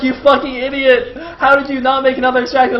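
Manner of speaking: loud, high imitation of an angry telling-off; mock fury at a blundering player; mad scolding. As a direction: chew him out, playing it up. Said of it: "look at how mad Lita is".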